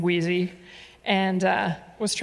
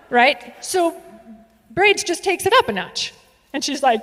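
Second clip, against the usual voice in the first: ordinary talk, nothing marked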